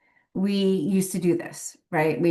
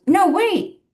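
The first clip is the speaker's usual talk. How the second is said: high, louder mock interjection; imitated protest; 13 semitones higher, 4 dB louder than usual. Elderly wife stopping husband from grabbing bag, imitated protest. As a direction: pitch it way up, imitating her. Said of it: "and she goes".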